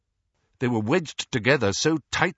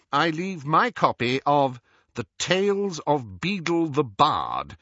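Scrimgeour reading a will; 7 semitones higher than usual. Acted. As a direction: read the bequest out like a proclamation. Scrimgeour reads the will aloud.

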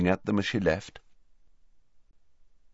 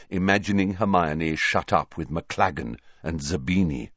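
plain reading voice

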